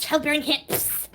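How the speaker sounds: slow, high, drawn-out mocking voice. Streamer mocking a rival in a slow high voice. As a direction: stretch it out like a taunt.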